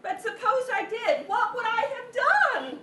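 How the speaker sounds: insist, voice climbing in alarm; near-hysterical worry. Acted. Victim pressing Mel about the burglars, frantic.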